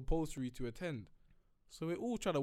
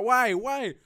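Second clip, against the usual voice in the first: shocked protest; 8 semitones higher, 12 dB louder than usual. Retold or imitated. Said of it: "I'm like".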